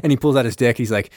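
ordinary talk, nothing marked

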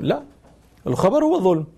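ordinary talk, nothing marked

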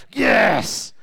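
a loud cheer as the villain falls; triumphant yes. Retold or imitated. Moviegoer cheering the bad guy's downfall, loud and triumphant. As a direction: punch the air and shout it. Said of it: "the bad guy gets it and you go".

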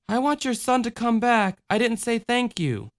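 put-on high childlike voice; little girl voice. A narrator's reading.

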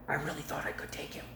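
hushed, whispered admission; confiding whisper. Real, not acted. Preacher admitting he thought he'd win, confiding whisper. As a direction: whisper it like a secret.

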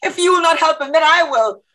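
high, exasperated, scoffing defiance; defiant. Acted. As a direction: laugh it off, then declare it angrily. Protester defies the cop.

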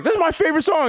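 high-pitched mock excitement; excited club fan. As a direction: squeal it like a thrilled clubber. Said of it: "someone's like".

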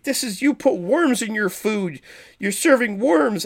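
high-pitched imitated scolding; mock yelling. Sheriff accusing the chef over wormy food, high mock yelling. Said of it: "he's yelling at the".

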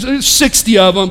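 ordinary talk, nothing marked

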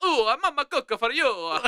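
high, hammed-up Italian accent; mock Italian. Shown as a demonstration. Host demonstrating his mock Italian accent to cohosts. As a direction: belt it like a cartoon Italian mama. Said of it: "That's Italian".

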